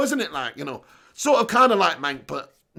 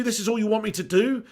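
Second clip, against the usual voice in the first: normal talking voice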